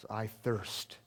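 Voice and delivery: suffering. Acted. Jesus on the cross, parched and exhausted, faint. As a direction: barely voice it, spent with thirst. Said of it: "a simple phrase that sums up his suffering".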